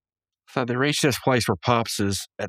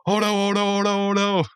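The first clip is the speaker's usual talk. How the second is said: hammed-up Homer panic; instant mock panic; 10 semitones higher than usual. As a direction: flip straight into cartoon panic. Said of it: "Super calm and collected".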